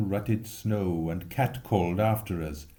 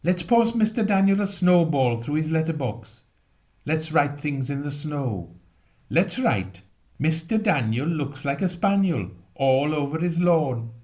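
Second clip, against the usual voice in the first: higher and louder, gleeful schoolboy plotting; mischievous boy; 5 semitones higher, 4 dB louder than usual. Acted. Boy urging his friends to a prank, gleeful. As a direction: egg your friends on with glee.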